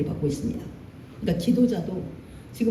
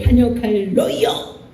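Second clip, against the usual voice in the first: mock angry rebuke; 5 dB louder, slower than usual. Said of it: "내 감정을 왕창 담아가지고 쏟아내는 겁니다".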